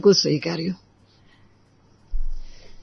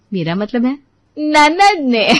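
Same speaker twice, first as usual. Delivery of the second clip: ordinary delivery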